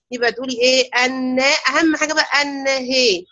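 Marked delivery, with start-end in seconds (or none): 2.4-3.2 "النهي" with an open "an-na", then the ha tilted toward "hee"; إمالة الهاء. Shown as a demonstration. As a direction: keep the noon open, tilt only the ha in "النهي". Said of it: "هتقربي فتحة الهاء ناحية الكسرة". (إمالة فتحة الهاء في "النهي" نحو الكسرة والنون مفتوحة)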